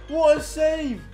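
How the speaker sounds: ordinary talk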